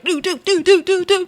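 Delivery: quick high "do do do" punch noises; imitated punches. Recapping a fast fistfight, high imitated punch sounds. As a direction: sound out fast flying fists. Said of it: "I just see hands like".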